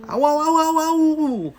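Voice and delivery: high, loud yelping scream; wolf scalded in the boiling pot. Acted. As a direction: yelp in burning pain. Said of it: "screamed the wolf".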